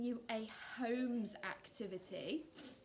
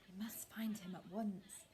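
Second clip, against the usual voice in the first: urgent hushed whisper; 4 semitones lower, 6 dB quieter than usual. Acted. Whispered line.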